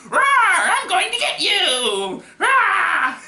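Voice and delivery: very high, loud, drawn-out roar; hammed-up monster chase shout; menacing robot. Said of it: "where the robot is chasing the Mystery Machine".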